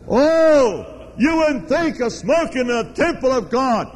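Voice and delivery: high, mocking pious voice; mock pious Baptist. Preacher mocking a Baptist's smug scolding, high and sneering. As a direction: sneer like a self-righteous churchgoer. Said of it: "They go".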